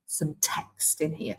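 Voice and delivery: unvoiced, dropped to a whisper, slow; whispered aside. Real, not acted. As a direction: whisper it to yourself while typing. Muttered aside while typing.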